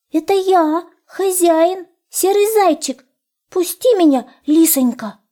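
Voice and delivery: high, plaintive little hare voice; pleading hare. A narrator's reading. Hare begging the fox to let him in, high pleading. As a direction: beg in a thin high voice. Hare begs at locked door.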